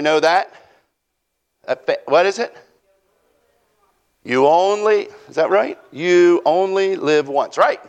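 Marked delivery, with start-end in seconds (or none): none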